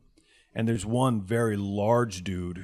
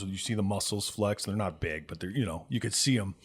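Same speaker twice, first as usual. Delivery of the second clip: ordinary delivery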